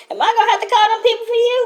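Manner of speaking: high, fast, hammed-up yelling; shouting. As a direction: bark at them like a crazed dad at the grill. Continues mock yelling.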